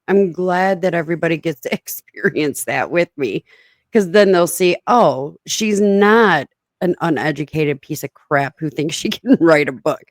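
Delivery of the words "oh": normal talking voice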